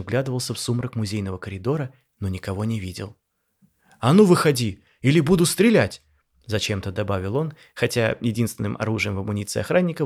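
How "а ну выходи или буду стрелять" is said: loud, high threat into the dark; frightened guard yelling a warning; shouting. A narrator's reading. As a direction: bark a threat at an empty hall. Guard shouts at hidden voices.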